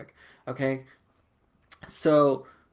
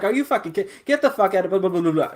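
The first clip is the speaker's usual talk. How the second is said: fast, high retold tirade; pissed off; 4 semitones higher, 5 dB louder, much faster than usual. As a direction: imitate an angry outburst dissolving into babble. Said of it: "I was pissed as a motherfucker".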